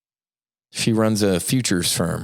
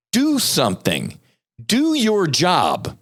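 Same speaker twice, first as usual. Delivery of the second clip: fed-up demand; 9 semitones higher, slower than usual. Real, not acted. Demanding FDA act.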